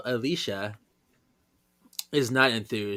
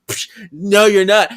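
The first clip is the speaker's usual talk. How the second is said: loud, higher protest; forceful refusal; 7 semitones higher, 11 dB louder than usual. Retold or imitated. Friend knocks the gun arm away, loud forceful refusal. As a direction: shut it down hard, raised voice.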